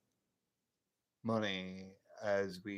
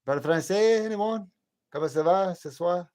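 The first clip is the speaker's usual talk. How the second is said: calling loudly to chat in French, higher; loud French greeting; 9 semitones higher, 11 dB louder than usual. Real, not acted.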